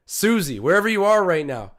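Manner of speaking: ordinary delivery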